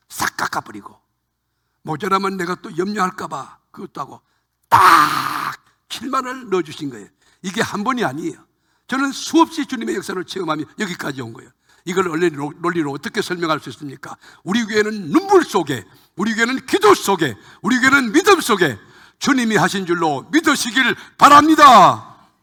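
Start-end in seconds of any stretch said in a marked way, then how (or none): 4.7-7.1 louder, breaking into tears; the voice cracks with emotion; choked up. Real, not acted. Moved retelling exact sum received. 14.4-22.3 louder, higher rousing proclamation; rally the congregation; preaching at full force. Acted. Preaching climax.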